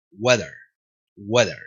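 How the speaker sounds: low "Weather" opening like Spanish "huevo". Shown as a demonstration. Teacher modelling the W sound, like huevo. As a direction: round the W of "Weather", weak final vowel. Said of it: "Weather suena similar a huevo".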